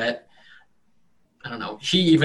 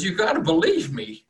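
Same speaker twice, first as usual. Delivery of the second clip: put-on higher stammering old farmer; higher farmer voice, nervous and humble; 8 semitones higher, 4 dB louder than usual. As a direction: stumble over words, eager to please. Farmer pleads.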